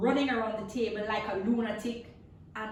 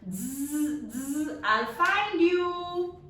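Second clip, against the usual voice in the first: 1 buzzing threat; 5 semitones higher, 5 dB louder, much slower than usual. Acted. Mosquito vowing to hunt lizard.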